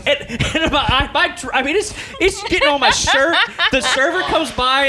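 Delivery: high, laughing through the story. Real, not acted. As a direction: giggle through every line. Laughing while retelling the challenge.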